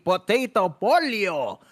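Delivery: slow, sing-song mock Italian; Potato Polio in a playful fake Italian accent. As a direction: savour the name like a hammy Italian. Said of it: "my fake Italian accent".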